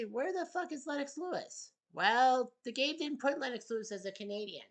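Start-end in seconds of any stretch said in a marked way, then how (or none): 0.0-1.7 higher-pitched, incredulous mock complaint; mock indignant viewer. Host imitating viewers asking where Lennox is, higher and mock-indignant. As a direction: imitate an outraged fan demanding an answer. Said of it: "Well, I know you'll say".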